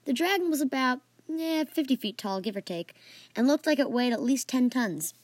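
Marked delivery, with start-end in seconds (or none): none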